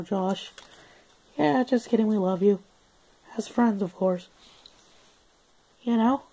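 high-pitched little dingo voice; Australian dog. Acted. Dog answers host asking for normal accent, high squeaky voice. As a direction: pipe up as a squeaky Aussie dog. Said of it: "We are Australian dogs".

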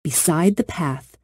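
ordinary delivery

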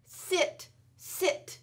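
usual speaking voice

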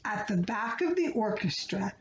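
ordinary delivery, nothing marked

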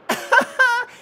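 high burst of real laughter; quick, high, amused laugh. Real, not acted. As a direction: laugh out loud, high-pitched. Laughs at a chat comment.

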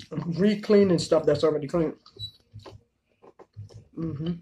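talking while chewing; eating mid-sentence; mouth full of chicken. Real, not acted. Seen 1: Eats chicken, brings food to mouth, chews while talking.

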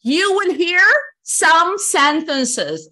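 some sentences drawn out very slowly; slow emphatic. Shown as a demonstration. Teacher spelling out instructions slowly and loudly. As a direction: stretch some sentences for the class. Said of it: "repeat instruction".

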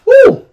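high, loud "woo"; excited whoop. Real, not acted. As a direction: whoop at the clash. Reacting to players clashing on video.

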